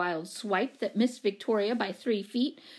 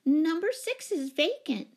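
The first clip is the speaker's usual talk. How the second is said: anxious; 8 semitones higher than usual. A narrator's reading. Miss Giddings butting in about a vacant apartment, anxious. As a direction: put it in anxiously, pitched up. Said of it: "put in Miss Giddings anxiously".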